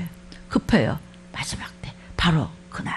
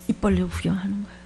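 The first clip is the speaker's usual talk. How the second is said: hushed aside; 3 semitones lower, 5 dB quieter, faster than usual. Real, not acted. Aside about onlookers.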